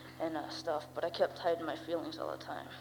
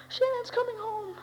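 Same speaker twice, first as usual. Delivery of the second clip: high, slow tearful wail; mom crying the news; 3 dB louder than usual. Retold or imitated. Mother sobbing to her son, tearful high wail. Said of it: "and I hear".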